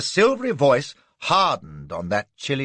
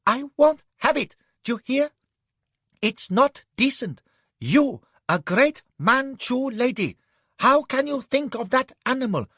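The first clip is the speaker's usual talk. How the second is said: near tears; 11 semitones higher than usual. Acted. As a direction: protest close to tears. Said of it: "He was nearly in tears".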